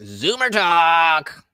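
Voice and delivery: slow, stretched mock announcement; playful drawn-out call. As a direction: drag it out like a silly callout. Playfully calling out a label.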